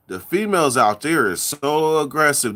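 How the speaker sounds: ordinary delivery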